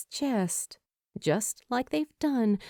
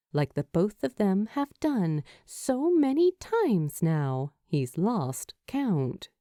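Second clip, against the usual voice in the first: ordinary delivery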